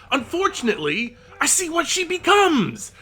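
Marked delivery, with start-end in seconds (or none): 1.4-2.9 high, forceful outburst; exasperated. Real, not acted. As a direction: punch it out in loud frustration. Exasperated rant about Abby.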